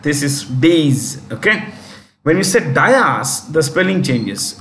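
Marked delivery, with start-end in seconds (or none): 0.4-1.2 "days" as one long syllable; long ay in "days", ending on a z; pronunciation demo. Shown as a demonstration. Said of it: "Say that". 2.7-3.5 "dais" said as DYE-as; two-part "dais", starting like "die"; incorrect pronunciation. Shown as a demonstration. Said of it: "which is incorrect spelling".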